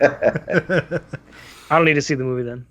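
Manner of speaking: slow words through real laughter; amused, laughing it off. Real, not acted. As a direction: chuckle the line out slowly. Joking about skipping the movie.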